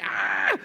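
high mock kid scream; piercing comic scream; imitated child's scream. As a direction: shriek like a screaming toddler. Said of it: "And it was just like".

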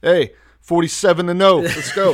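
loud, high comeback hype; mock triumphant boast. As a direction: crow like a returning champ, half laughing. Acting God's triumphant comeback.

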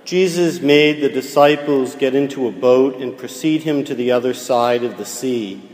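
ordinary delivery

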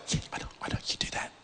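soft unvoiced whisper as God the Father. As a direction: whisper it gently, knowingly. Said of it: "and God the Father goes".

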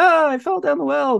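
high-pitched mock wail of a little girl; mock scream for help. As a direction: act out a kid crying for help, not full volume. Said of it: "she screams for help".

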